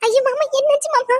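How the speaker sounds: high, frantic cries over his bleeding; panicked. Acted. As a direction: panic as she sees the blood. Finds father-in-law bleeding. (ரத்தத்தைக் கண்டு பதறிய அலறல்)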